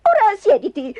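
high, exasperated order; brusque, high-pitched command; huffing. A narrator's reading. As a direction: huff it out impatiently. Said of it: "sbuffò Magda".

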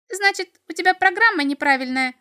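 high, loud, merry imp voice; cheerful little devil. A narrator's reading. Little devil judges the boy's programme faulty, cheerful. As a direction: conclude gleefully in a piping voice. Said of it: "весело заключил чертёнок".

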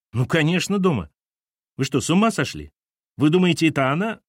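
put-on high, outraged teenage boy; indignant boy. Boy to captain, indignant and high. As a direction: bristle at the question.

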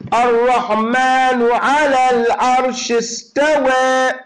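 loud, slow, solemn preaching; emphatic preaching. Acted. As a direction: proclaim it with slow force.

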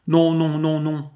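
high, loud pleading cries of no; a girl cries out against her father's plan, desperate; cried out. Acted. Zoé cries out in despair. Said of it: "s'écria-t-elle".

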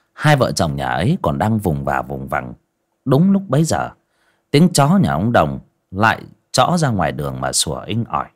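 ordinary delivery, nothing marked

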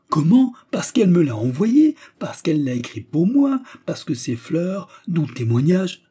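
high, loud outburst of proof; excited suitor. Acted. Suitor defending his claim to the manager, high and excited. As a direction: blurt it out with rising excitement.

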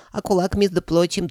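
very high, indignant creature voice; indignant dragon head. A narrator's reading. Middle head protesting about the knight, high and indignant. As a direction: protest in a piping falsetto. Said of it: "возмутилась средняя".

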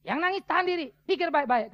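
high, loud firm order; retold shout. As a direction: shout instructions over a crying room. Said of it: "Wah, saya teriak".

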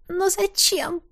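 high, breathy sob, disguised; sobbing, disguised voice. A narrator's reading. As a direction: whimper in a changed voice. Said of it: "всхлипнула я, попытавшись изменить голос".

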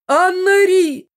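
very high, loud, drawn-out call; mother's scream. A narrator's reading. Mother cries for her daughter in the blast, shrieking call. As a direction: scream the daughter's name, stretched out. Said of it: "послышался крик мамы".

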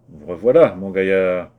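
loud, mocking welcome back; gloating mayor. Mayor gloating at the returned vagabond, loud mockery. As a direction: taunt him like a smug official.

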